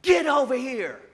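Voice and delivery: hammed-up boss barking a command; bossy yell. Boss ordering the worker over, loud and high. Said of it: "I could hear him yelling".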